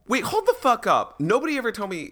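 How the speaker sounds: high, loud sudden outburst; surprised. Real, not acted. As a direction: stop everyone, caught off guard. Sudden realization mid-chat.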